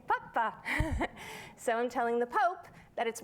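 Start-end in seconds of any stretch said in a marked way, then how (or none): 0.1-0.5 ad line papa. Retold or imitated. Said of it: "I say". 0.6-1.5 amused laugh. Real, not acted. Laughing at own story.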